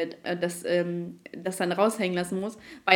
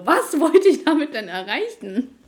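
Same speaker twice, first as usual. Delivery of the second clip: self-puzzled; 6 semitones higher, 5 dB louder, slower than usual. Retold or imitated. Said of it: "richtig über mich selbst gewundert".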